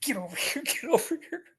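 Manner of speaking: squeaky retold summons; dad's urgent beckoning. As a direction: imitate an urgent dad, pitch leaping up. Dad urgently beckons him over.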